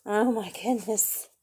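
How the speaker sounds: ordinary delivery, nothing marked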